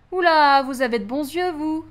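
surprised exclamation; a hint of an old lady teasing; lightly voiced old lady. Old lady teasing the young man, lightly surprised. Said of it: "dit une vieille dame".

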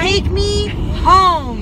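repeating to voice assistant. Acted. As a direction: drag each word out for the phone. Repeating command to Siri, louder.

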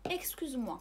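اكسكوز موا with a sharp ks at the start; French اكسكوز موا, x as unvoiced ks; ks sound. Shown as a demonstration. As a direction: say اكسكوز as "ekskyz". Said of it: "كنسمعوا كس".

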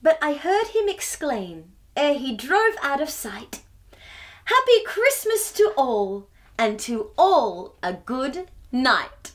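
rhythmic storybook poem reading; reading to children. A narrator's reading. Host reading the Christmas poem to children. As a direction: lilt the rhyme for little listeners.

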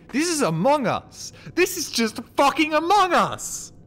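high, loud cry of disbelief; loud exclamation. Real, not acted. Gamer realising the game copies Among Us, yelling incredulously. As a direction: yell it high, stretching out the words.

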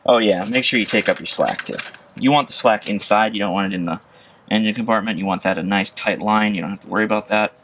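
ordinary delivery

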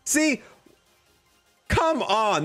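usual speaking voice

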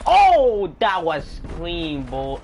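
high, loud, slow triumphant shout; excited cheer. Real, not acted. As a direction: crow over the kill. Celebrating a clean kill.

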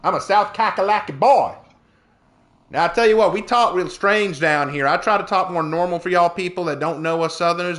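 playful down-home Southern twang; Southern accent. Pretend. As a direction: lay on the folksy Southern accent. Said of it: "do please only talk like that".

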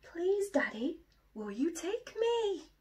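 high, pleading little bear voice; Little Bear asking. Acted. Little Bear begs his daddy to take him, pleading high. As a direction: beg like a small cub. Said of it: "he said".

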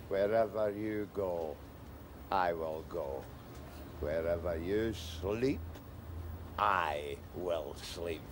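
low, soft, slow pledge; solemn vow. Acted. Man pledges to follow his rescuer, low and soft.

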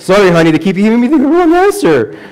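high, loud pleading up to a squeal; mock-protesting dad. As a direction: plead loudly with your daughter, voice climbing. Father mock-protesting to his daughter.

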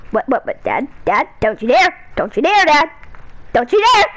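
ordinary talk

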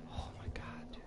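breathless whisper. Real, not acted. Hiding from the guard, whispering. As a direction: whisper it so the guard won't hear.

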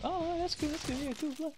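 high, soft mock fan voice; small, high-pitched imitation of a fan; acted fan reaction. Acts out an awed fan.

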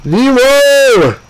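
long high bellow of the name; shouting a name. Real, not acted. Frustrated player calling Nemo, loud high bellow. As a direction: call him in from across the ship.

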